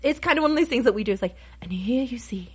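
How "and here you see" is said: hushed nature-documentary narrator, fading to a whisper; a hushed, awed Attenborough impression; David Attenborough. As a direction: softly narrate like a wildlife film. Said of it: "the David Attenborough thing".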